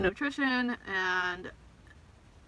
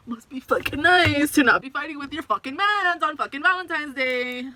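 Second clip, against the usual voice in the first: louder, bitter, sarcastic jab; bitter sarcasm; 6 semitones higher, 6 dB louder than usual. Retold or imitated. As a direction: spit out the envy sarcastically. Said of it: "And I'm just like".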